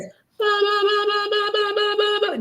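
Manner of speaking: put-on very high, bright baby babble sounds; toddler babble. Medium imitates the little boy spirit for the client, high toddler babble. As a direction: bounce out the ba's like a clapping little boy. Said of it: "just like that".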